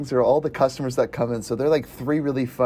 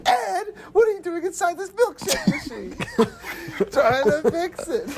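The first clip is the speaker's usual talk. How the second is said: high, squealing quotes broken by laughter; laughing through quotes; 14 semitones higher than usual. Retold or imitated. Fan reciting the milkshake scene, laughing high. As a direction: quote it while cracking up. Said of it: "quoting movie scene, laughing".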